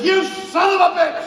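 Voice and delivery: high, loud hammed-up curse; mock angry yell. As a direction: yell it at the prankster friend. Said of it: "he says".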